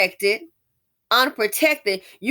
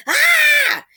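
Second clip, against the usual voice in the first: high, loud mock holler; mock scream; 9 dB louder than usual. Retelling the scared man in the ad, mock scream. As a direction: let out a high shrieking holler. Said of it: "Just starts hollering".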